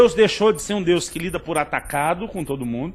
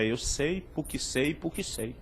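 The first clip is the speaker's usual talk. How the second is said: low, hushed retold answer; understated, dropping away with each repeat; quiet certainty; 4 semitones lower, 12 dB quieter, slower than usual. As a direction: repeat it softly, fading almost to nothing. Retold stubborn answer, trailing down.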